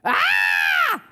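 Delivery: high, loud acted scream. A narrator's reading. Henry feels something grab his leg, loud scream. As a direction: shriek in fright. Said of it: "screamed horrid Henry".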